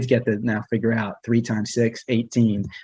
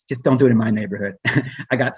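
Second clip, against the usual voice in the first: ordinary talk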